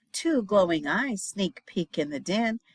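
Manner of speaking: usual speaking voice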